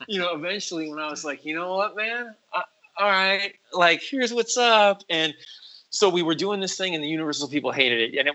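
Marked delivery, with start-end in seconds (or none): 1.4-5.0 retold attitude. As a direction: replay standing up for yourself, pitched up. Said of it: "my attitude started to kick in".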